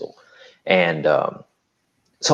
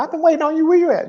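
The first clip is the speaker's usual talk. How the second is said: much higher, playful imitation of his mentee; imitating the mentee; much faster than usual. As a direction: play her teasing him right back, pitched way up. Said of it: "she's like".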